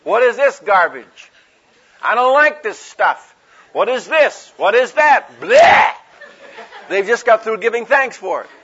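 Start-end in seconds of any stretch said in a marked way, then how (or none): 0.0-5.3 loud, hammed-up griping about food; loud, mock-appalled grumbler; mock disgusted complaining. 5.4-5.9 loud, high "bleh" of revulsion; hammed-up gag of distaste; mock disgust noise. Acted disgust at the food.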